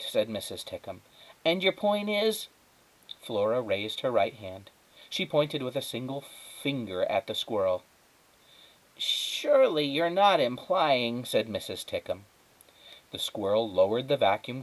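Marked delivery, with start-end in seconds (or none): 1.4-2.5 higher, prodding question; lightly voiced skeptical neighbour. Neighbour prods the girl. 9.0-11.3 higher and slow; lightly voiced incredulous neighbour. Neighbour doubts superhero squirrel.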